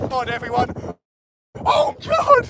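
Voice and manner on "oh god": very high squeal of amazement; excited cry. Real, not acted. Angler squealing at the fish on deck, amazed. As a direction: gasp it out in awe.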